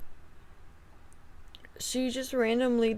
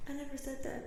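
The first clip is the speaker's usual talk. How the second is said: soft mock denial; 5 semitones higher, 12 dB quieter, faster than usual. Imagined relative's denial.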